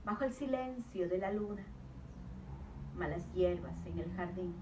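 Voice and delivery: hushed-paced verse with long pauses between short phrases; spare, deliberate poetry reading; poem recitation. Real, not acted. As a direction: recite line by line, letting each image hang. Recites her own poem.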